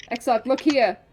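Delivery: calling out loud, with force, to the screen; shouted call. Real, not acted. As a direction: yell it at the character to get his attention. Shouting at a game character.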